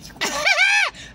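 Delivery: squealing high laugh; a sudden delighted high laugh; high burst of laughter. Real, not acted. Laughing at her own dance moves for viewers.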